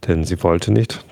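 plain reading voice

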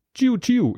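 hohes Laser-Tschiu; Kit-Geräusch. A narrator's reading. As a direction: das Knight-Rider-Auto hoch nachmachen. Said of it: "imitiere ich das klassische Kit-Geräusch". (hohes imitiertes Kit-Geräusch)

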